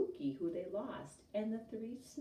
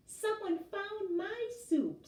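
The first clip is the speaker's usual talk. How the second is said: very high, slow squeaky voice; high squeaky Baby Bear; 4 dB louder, slower than usual. A narrator's reading. Baby Bear sputtering over lost soup, high and squeaky. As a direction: squeak it out like a tiny cub. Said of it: "in a high, squeaky voice".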